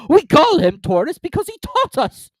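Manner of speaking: loud, high indignant turtle; hammed-up cross turtle; angrily. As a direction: snap it out impatiently. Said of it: "said the Mock Turtle angrily".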